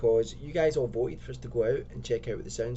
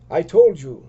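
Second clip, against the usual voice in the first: loud, higher-pitched outburst; wrong character's voice; 5 semitones higher, 15 dB louder than usual. Acted. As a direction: blurt it out very loud. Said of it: "that's me that's saying that".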